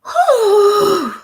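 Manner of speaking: long high, loud "hoo"; loud drawn-out whoop. Real, not acted. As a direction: let out a loud, high hoot that drops at the end. Wishing she saw the video.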